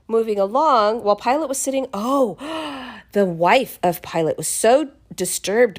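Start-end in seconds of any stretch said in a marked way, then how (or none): 2.4-2.9 sharp storyteller's gasp; dramatic gasp. Acted. Storyteller before Pilate's wife sends her note, dramatic gasp. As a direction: suck in a breath for effect.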